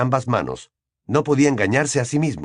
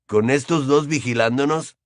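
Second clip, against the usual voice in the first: ordinary delivery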